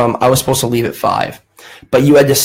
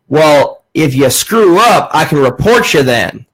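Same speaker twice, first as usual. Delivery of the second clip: mock Southern warning, drawn out on the key words; hammed-up Southern threat; Southern accent. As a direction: imitate an old Southern caller threatening. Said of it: "he had a southern accent".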